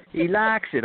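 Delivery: high Southern twang on LIKES; mock Southern yell. Director re-enacts the crewman's cry, mock Southern yell. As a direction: imitate a Southern holler, drawing out LIKES. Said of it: "and then he yelled out".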